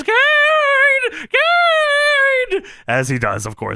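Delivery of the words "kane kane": very high, drawn-out wail; loud high-pitched cry for help; Paul Bearer. Retold or imitated. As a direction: call out the name long and high. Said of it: "and Paul Bearer says".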